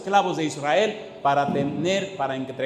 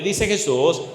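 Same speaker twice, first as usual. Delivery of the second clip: ordinary talk, nothing marked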